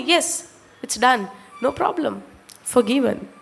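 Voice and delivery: low, soft and unhurried; easy forgiveness. Acted. Preacher acting out forgiving someone a small amount, low and soft. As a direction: let it go quietly, word by word.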